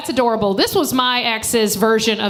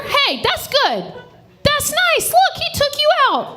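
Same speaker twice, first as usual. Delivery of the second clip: high mock gushing; slower than usual. Real, not acted. Comic gushing at couple.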